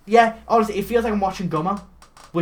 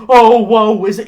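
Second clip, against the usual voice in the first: loud, higher mock woe; creepy Santa moan; 5 semitones higher, 14 dB louder than usual. Podcaster mimicking Santa's eerie lament, loud mock woe. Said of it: "it sounds creepy".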